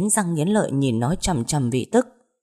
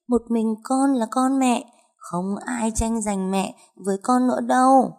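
put-on eerie ghost child; 6 semitones higher than usual. Ghost child claiming a grieving mother as its own, eerily high. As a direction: coax her in a high, haunting child-spirit voice.

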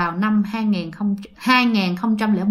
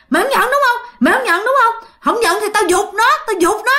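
put-on menacing old woman; 10 semitones higher than usual. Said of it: "cái mặt bà trở nên hung dữ lạ kỳ". (giọng bà già hung dữ, cao và to, đe dọa)

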